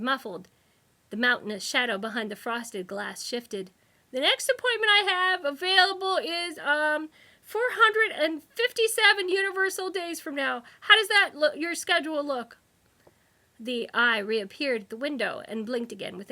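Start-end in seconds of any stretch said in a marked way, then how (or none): 4.1-12.6 high, loud clerk's voice; a busy receptionist brushing him off; fussy receptionist. A narrator's reading. Receptionist voice held.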